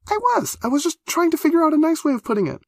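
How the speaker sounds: shrill-high, fast defensive rush; very high flustered girl. A narrator's reading. Defending herself.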